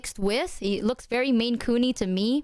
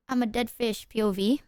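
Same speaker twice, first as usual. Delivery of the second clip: normal talking voice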